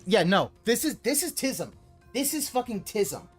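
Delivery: normal talking voice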